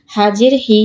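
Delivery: no stretch on হি; short vowel. Shown as a demonstration. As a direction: end on a short হি. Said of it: "কোথায় দ্রুত পড়ি".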